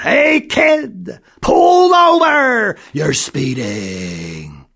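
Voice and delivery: high, loud, slow shouted order; police yell. A narrator's reading. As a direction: bellow from the patrol car.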